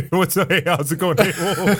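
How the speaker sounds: lightly voiced, giggly Robin Williams bits; a loose, laughing take on a manic comic voice; Robin Williams impression. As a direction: toss off quick manic hellos through laughter. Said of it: "Can you do a short impression for us?".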